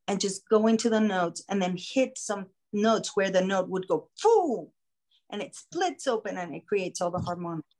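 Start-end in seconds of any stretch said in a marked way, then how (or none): 4.2-4.7 vocal burst. Shown as a demonstration. Imitates the note splitting open.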